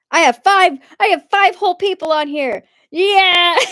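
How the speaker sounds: high, loud and thrilled; excited. Real, not acted. Excited about five viewers.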